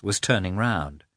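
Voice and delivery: ordinary delivery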